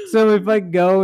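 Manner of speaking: normal talking voice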